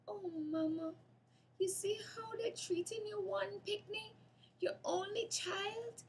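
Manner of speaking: high, tearful plea to her mother; crying. A narrator's reading. Girl crying to her dead mother, tearful and high. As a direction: voice breaking as she begs Mama. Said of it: "Keisha cried".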